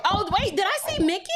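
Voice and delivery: high, hopeful double take; surprised. Real, not acted. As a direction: pitch up as if spotting someone unexpected. Spots a viewer in chat.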